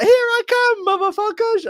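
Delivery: very high mock holler; gung-ho battle cry. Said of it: "It's like".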